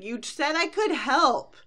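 put-on high, loud, drawn-out kid complaint; complaining little sister. Bored little sister to Rarity, high drawn-out complaint.

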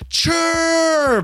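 high, excited shout of a name; a kid spotting a lost friend; boy running to hug a friend, high joyful cry. Acted.

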